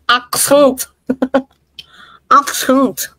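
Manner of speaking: "Accent" in exaggerated British vowels, with laughter; mock British accent. Apologizing to Kaufman over the accent, laughing mock British accent. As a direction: tease the British way of saying "Accent". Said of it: "di namin naintindihan yung accent".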